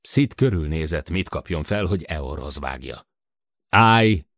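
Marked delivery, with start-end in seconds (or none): 3.7-4.2 sharp loud shout, raised in pitch; shouted command. A narrator's reading. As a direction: shout it as an order to halt. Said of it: "kiáltotta Jill".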